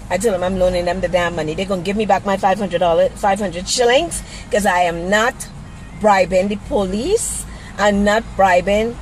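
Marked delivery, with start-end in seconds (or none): none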